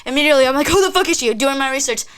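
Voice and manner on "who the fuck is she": high, loud retold question; indignant demand. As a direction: reenact snapping at him about the rival. Retold demand about other girl.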